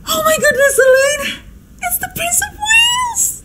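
gasping, very high squeak of amazement; gasp, then squeal it half-voiced; breathless shock. Hostess telling daughter who the royal visitor is, shocked squeal.

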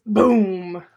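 loud, booming sound word; explosive boom. A narrator's reading. Narrator tells of the contraption exploding, booming loudly. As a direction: burst it out like a blast.

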